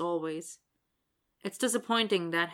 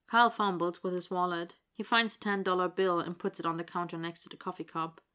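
ordinary delivery, nothing marked

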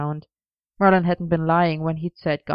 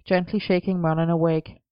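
usual speaking voice

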